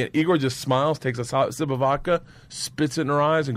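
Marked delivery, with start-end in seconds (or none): none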